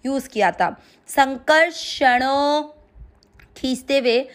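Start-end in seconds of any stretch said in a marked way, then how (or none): 1.1-2.7 संकर्षणो with the णो drawn out long; संकर्षणो, slow, last vowel held; long final o. Shown as a demonstration. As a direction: stretch the णो at the end of संकर्षणो. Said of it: "णों की मात्रा को लंबा खींचना है".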